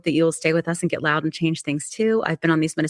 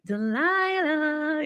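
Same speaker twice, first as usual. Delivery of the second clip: high, very slow breathy Delilah; sultry drawn-out name; 10 semitones higher, much slower than usual. Acted. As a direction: stretch the name out like a radio host. Mimicking late night radio host.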